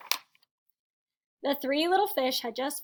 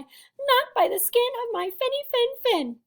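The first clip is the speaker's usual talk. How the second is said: high-pitched frightened little fish; high, mildly fearful storybook voice; trembling little fish; 10 semitones higher, 4 dB louder than usual. A narrator's reading. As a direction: squeak a refusal as a scared fish. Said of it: "trembled and replied".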